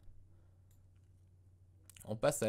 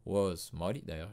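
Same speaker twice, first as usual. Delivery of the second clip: ordinary talk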